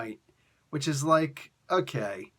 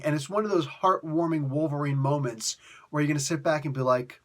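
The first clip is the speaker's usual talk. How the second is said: ordinary talk, nothing marked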